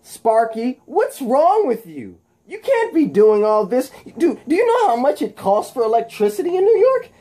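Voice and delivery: high, loud squeaky taunting shout; cartoonish high-pitched scolding of a foe; Spider-Man. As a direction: yell the wisecrack way up high. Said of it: "And Spider-Man goes".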